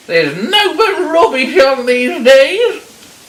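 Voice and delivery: high, loud granny shouting through a door; crotchety granny turning a caller away; suspicious old granny. Acted. Granny to the caller at her door, loud and dismissive.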